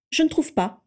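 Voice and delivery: a sharp, clipped-off reply; curt retort. A narrator's reading. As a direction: snap it back at him, cool and short. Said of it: "rétorqua sèchement Narcissa". (réplique sèche, d'un ton cassant)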